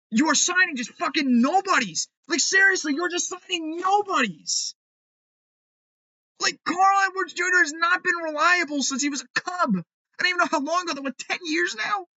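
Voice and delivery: high-pitched, incredulous rant; exasperated. Real, not acted. Fan mocking the cheap signings, high exasperated rant. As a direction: pitch up in disbelief.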